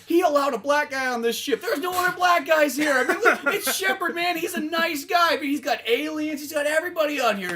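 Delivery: high-pitched, insistent crewman's defence; earnest hype. Pretend. Crew member vouching for Shepard to shipmates, over-eager praise. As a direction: gush about the hero in a hammed-up high voice.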